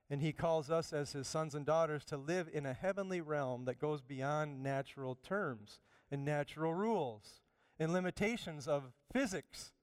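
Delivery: ordinary talk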